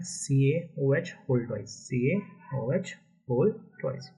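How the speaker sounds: very slow, deliberate सीएओएच whole twice; formula reading demo. Shown as a demonstration. Teacher repeating for students how to read the formula, slow demonstration. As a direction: draw out each part of सीएओएच whole twice. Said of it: "इसको read करते time कैसे पढ़ना है".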